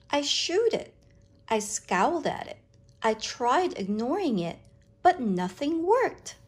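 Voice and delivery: ordinary delivery, nothing marked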